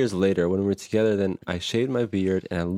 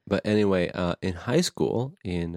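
ordinary delivery

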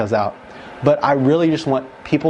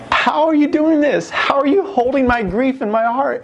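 awed retold question; 10 semitones higher than usual. Retelling awed question to God.

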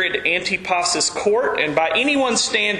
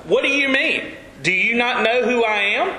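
talking down. Acted. Haughty official of Herod's court to Jesus, talking down. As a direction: pull rank in mock affront. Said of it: "did not talk down to Jesus like".

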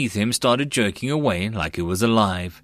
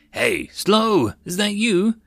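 high-pitched questioning hail; calling to him; 10 semitones higher, slower than usual. A narrator's reading. Old comrade hailing Slow among the fruits, calling. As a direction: call over to someone you recognise. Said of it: "someone called to him".

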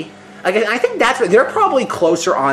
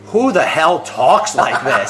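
slow, drawn-out mock bafflement; retold incredulity; much slower than usual. Podcaster retelling an actor's baffled reaction to the script, mock incredulous. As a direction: stretch it out in disbelief, quoting someone.

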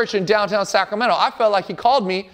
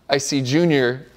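low, subdued mock-wimpy reply; wimpy, weak; 7 semitones lower than usual. Preacher voicing Junior at the mirror, wimpy and weak. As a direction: sound a little beaten down, lightly acted. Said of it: "he's all, like, wimpy and, and weak".

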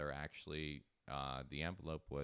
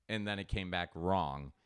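normal talking voice

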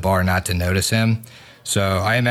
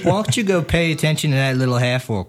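snippy barmaid; 7 semitones higher than usual. Acted. Barmaid snipes back.

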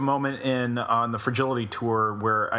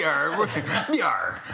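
hearty pirate growl; a playful "yar" like a pirate; 6 semitones higher than usual. Pretend. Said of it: "Pirate voice".

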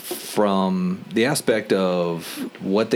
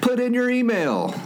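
squeaky voice. Acted. Playful high plug.

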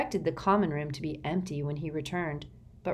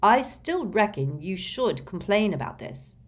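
ordinary delivery, nothing marked

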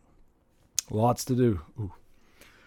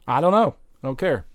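louder, higher, brushing it off; mock outburst; 5 semitones higher, 5 dB louder than usual. Retelling his reply to everyone, mock defiant burst. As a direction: snap out of it with a cocky burst. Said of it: "And I'm like".

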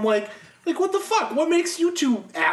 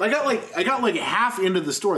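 usual speaking voice

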